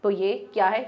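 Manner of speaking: ordinary delivery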